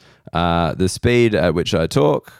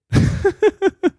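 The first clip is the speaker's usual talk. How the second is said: laughing. Real, not acted. Laughs at own story.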